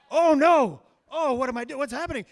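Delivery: loud, high burst of comic panic; panicked inner voice. Retold or imitated. Acting out panicked thoughts.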